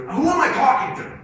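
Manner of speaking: loud, high irate-caller impression; mock screaming. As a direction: imitate a customer yelling down the phone. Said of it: "And he's screaming at it".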